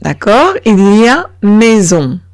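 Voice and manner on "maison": "maison" with a voiced z for the s. Shown as a demonstration. Teacher concluding the two-vowel rule, buzzed z. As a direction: say "maison" as may-zon. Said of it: "como si fuese una Z".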